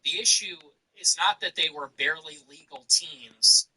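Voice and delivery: normal talking voice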